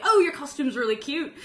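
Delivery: hammy Australian impersonation with a laugh; terrible Australian accent. Retold or imitated. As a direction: badly fake an Australian accent. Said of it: "the Australian accent, which I will do an absolutely terrible impersonation".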